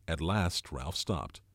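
ordinary delivery

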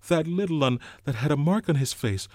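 urgent, worried asking; Piggy alarmed. A narrator's reading.

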